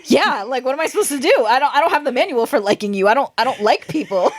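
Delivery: flustered panic. Real, not acted. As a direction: spiral into mock panic about falling for him. Panicking about liking him.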